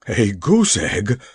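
slow, aghast echo of the threat; horrified echo. Acted. The Nome King hears he may become a goose egg, slow horrified echo. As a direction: repeat it slowly as if the idea appals you. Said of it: "echoed the horrified King".

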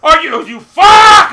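loud, high, drawn-out shout; furious curse. Real, not acted. Gamer who hates this game, furious shout. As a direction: explode on the last word.

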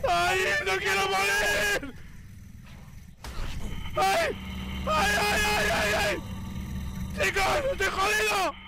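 high, drawn-out shrieks of fear; terrified screaming. Real, not acted. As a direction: scream as you die. Dying in game, screaming.

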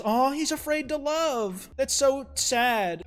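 high, slow cooing sympathy; mock pity. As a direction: draw it out like cooing at a baby. Coos over the shy character.

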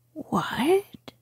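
a sudden high whispered what; astonished whispered cry. Acted. The rat hearing the mole has never boated, astonished whisper. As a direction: gasp it out in whispered disbelief. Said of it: "cried the rat, open-mouthed".

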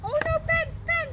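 very high, sing-song chant. Real, not acted. Playful chant at toddler.

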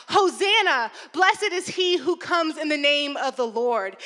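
loud, high acclaiming shout; crowd cry. Acted. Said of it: "crowds cried out".

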